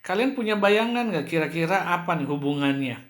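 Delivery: usual speaking voice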